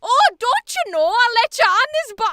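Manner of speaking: loud, high Irish man; exaggerated Irish accent, near shout; cartoonish Irish accent. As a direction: boom it out like a cartoon Dubliner. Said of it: "this man goes".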